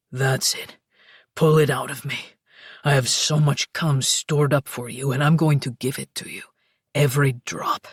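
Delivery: deep aroused lover; low, urgent male lover. Acted. Luca voiced by narrator.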